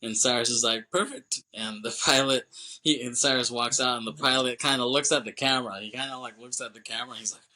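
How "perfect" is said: high mock pleased. Cyrus pleased by sandstorm.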